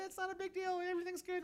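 soft, high soothing parent voice; mock reassurance. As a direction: hush it up high, soothing a hurt kid. Retold soothing lie to his kid.

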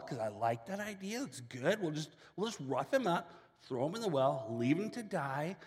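ordinary talk, nothing marked

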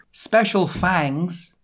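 mock-solemn dedication, word by word; author's note read-out. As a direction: spell out the silliness with heavy deliberation. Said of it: "This is the author's note".

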